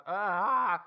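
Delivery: short mock anxiety yelp; mock panicked cry. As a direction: cry out as if overwhelmed, half joking. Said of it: "a low-key anxiety attack".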